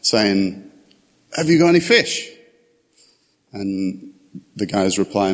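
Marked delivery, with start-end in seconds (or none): 1.4-2.3 high, far-off call across the water; a stranger on the beach calling to fishermen, far-off call; calling from the shore. Retold or imitated. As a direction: call out from a distance to the boat. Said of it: "the voice comes out from there".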